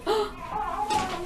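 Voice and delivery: high, breathy gasp of dismay; sharp intake of startled air; mock shocked gasp. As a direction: gasp as if suddenly stunned. Retold shock at receiving the ring.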